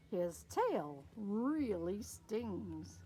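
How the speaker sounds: dragged-out warning; slow warning to the listening kids. Pretend. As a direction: stretch it out slowly for effect.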